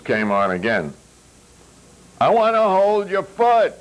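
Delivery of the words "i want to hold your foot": high, loud silly shout. A narrator's reading. Yuri yelling the lyric on the dance floor, high and loud. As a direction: belt the twisted lyric over the music. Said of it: "Yori shouted".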